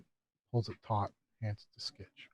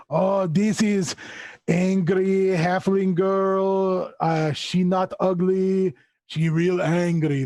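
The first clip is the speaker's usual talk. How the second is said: slow, higher creature voice with stretched words; Skitch, simple creature; 5 semitones higher, 4 dB louder, much slower than usual. Acted. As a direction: drawl it out in broken speech.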